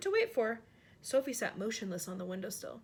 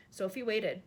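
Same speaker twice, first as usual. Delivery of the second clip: usual speaking voice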